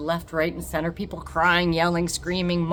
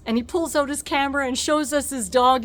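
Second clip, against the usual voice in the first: ordinary talk, nothing marked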